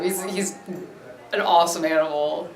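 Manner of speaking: ordinary talk, nothing marked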